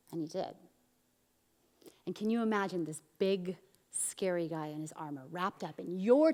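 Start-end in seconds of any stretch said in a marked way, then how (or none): none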